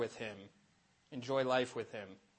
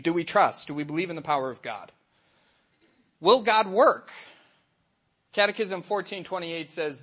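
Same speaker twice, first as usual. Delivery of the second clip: ordinary talk, nothing marked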